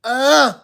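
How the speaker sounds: mock a daughter's loud "ugh"; disgusted ugh. Daughters snubbing their dad, loud mocking groan. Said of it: "Some, some daughters are just like".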